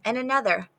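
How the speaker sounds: plain reading voice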